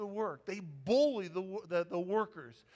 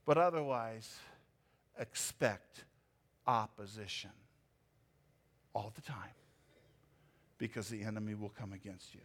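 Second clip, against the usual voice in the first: much lower and softer, ominous; grave low warning; 10 semitones lower, 3 dB quieter than usual. Acted. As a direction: drop low and quiet to warn of the enemy. Grave warning after irony.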